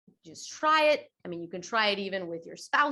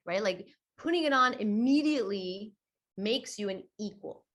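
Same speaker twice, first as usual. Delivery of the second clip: usual speaking voice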